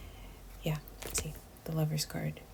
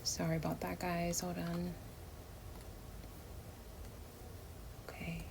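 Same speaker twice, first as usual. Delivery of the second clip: much softer, whispered to herself; muttered aside; 2 semitones higher, 12 dB quieter than usual. Real, not acted. Reader sorting cards off to the side, hushed. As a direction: step away from the mic and murmur. Off-mic aside during shuffle.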